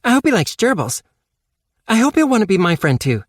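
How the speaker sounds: put-on high, fast eager girl voice; Casey eager. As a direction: rush out her hopes in a high girl voice. Casey's eager hopes on plane.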